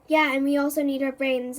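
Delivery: ordinary delivery, nothing marked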